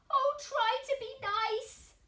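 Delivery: high pleading whimper; whimpering plea. Acted. Frightened lion begging the mouse, high and whimpering. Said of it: "he whimpered".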